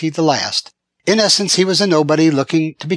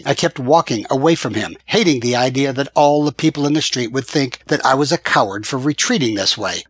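ordinary delivery, nothing marked

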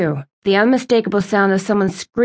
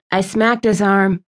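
ordinary delivery, nothing marked

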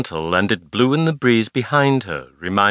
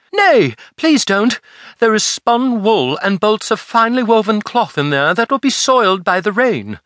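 pleading; 10 semitones higher, 4 dB louder than usual. Acted. Girl begging the dockmen to leave her goods, high and pleading. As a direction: beg them desperately to stop.

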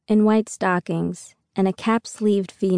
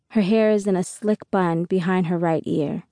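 plain reading voice

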